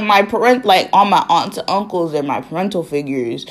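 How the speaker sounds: ordinary talk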